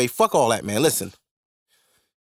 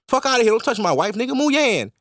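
mock angry husband; 5 semitones higher than usual. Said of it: "He tried to touch Nicki".

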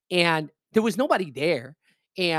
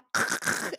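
raspy voiceless snickering laugh; mock a creepy old woman's laugh; imitated old-lady cackle. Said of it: "starts laughing".

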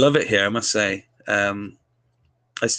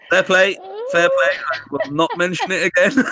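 laughing reply; 8 semitones higher, 4 dB louder than usual. Retold or imitated. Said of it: "And I was like".

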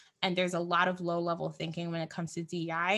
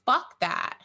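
normal talking voice

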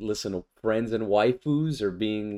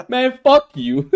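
mock outrage; 12 semitones higher, 8 dB louder, slower than usual. Mock frustration at overpowered hero.

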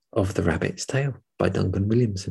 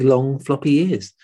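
ordinary delivery

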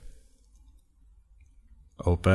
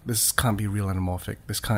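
hushed disbelief; 10 semitones lower, 14 dB quieter than usual. Retold or imitated. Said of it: "I'm like".

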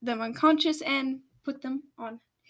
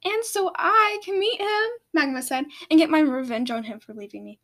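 eager vow; 5 semitones higher, 4 dB louder than usual. Acted. Magma vows revenge.

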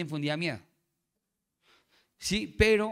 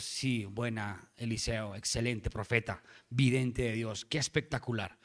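quiet admiration; 7 semitones lower, 6 dB quieter than usual. Retold or imitated. King privately approving the prophet's report, quiet admiration. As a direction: voice the king's private approval, low and soft.

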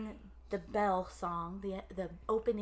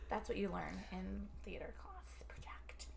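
soft unvoiced whisper; 2 semitones lower, 11 dB quieter than usual. Real, not acted. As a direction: whisper the irony.

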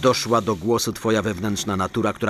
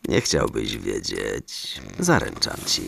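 menacing; 12 semitones lower, 4 dB quieter, slower than usual. Acted. Santa threatens captive.

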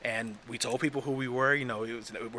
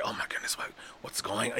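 hushed, voiceless gossip whisper; 2 semitones lower, 7 dB quieter, faster than usual. Acted. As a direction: whisper it like a scandalized onlooker. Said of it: "a few whispers like".

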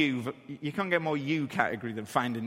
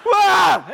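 much higher, much louder shriek of a whoa; terrified whoa; 13 dB louder than usual. Retold or imitated. Dad falling backwards on the coaster, shrieking. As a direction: scream as the ride drops.

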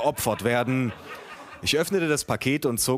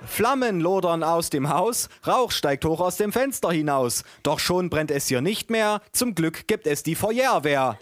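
higher-pitched, deliberate singsong verse; children's rhyme reading; 5 semitones higher than usual. A narrator's reading. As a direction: read the rhyme aloud with exaggerated care. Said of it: "las meine Tante vor". (übertrieben vorgetragener Kinderreim)

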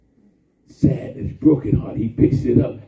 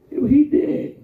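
mock mourner's protest; 13 semitones higher, 5 dB louder than usual. Mourners object to Jesus.

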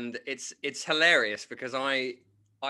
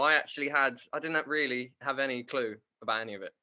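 normal talking voice

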